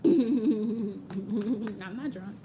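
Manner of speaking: very slow, drawn out. Real, not acted. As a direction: drag every word out. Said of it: "You would think I sipped something".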